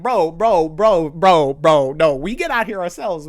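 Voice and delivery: loud mock protest; hammed-up loud disbelief; exasperated protest. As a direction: blurt it out, loudly objecting. Mock exasperated reply to plea.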